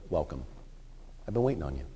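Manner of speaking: Jesus welcoming a fallen believer home, tender and hushed; tender welcome. Acted. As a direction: welcome them home gently, like a father.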